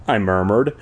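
usual speaking voice

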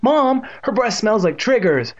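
high, drawn-out whining complaint; miserable exclamation. A narrator's reading. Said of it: "Evan exclaimed miserably".

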